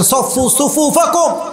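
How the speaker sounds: high, drawn-out chanted call; imam's call. Acted. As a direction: call the rows to order, slow and high. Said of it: "ওমরে ফারুক এসে বলছেন". (ইমামের টানা উঁচু ডাক)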